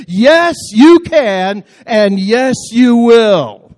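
very loud, high, leading the crowd; call and response shout. Real, not acted. Preacher leading the congregation in a loud chant. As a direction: shout it so everyone joins in. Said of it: "Come on, say it with me".

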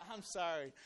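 soft laughing apology; real laughing. Real, not acted. Preacher apologizing to congregation mid-reading, laughing softly. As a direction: apologize through quiet laughter.

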